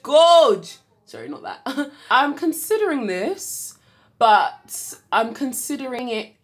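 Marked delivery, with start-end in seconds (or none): 0.0-0.8 loud high "gorge". Real, not acted. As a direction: belt out "gorge".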